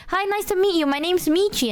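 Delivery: cheery self-introduction. Retold or imitated. Meeting a new friend for the first time, cheery greeting. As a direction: introduce yourself in a lifted, friendly voice. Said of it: "I was like".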